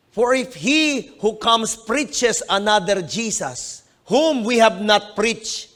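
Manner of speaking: slow, deliberate Bible reading; measured scripture read. Acted. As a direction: read the verse out to the congregation. Reads verse four aloud.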